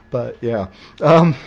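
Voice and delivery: ordinary talk, nothing marked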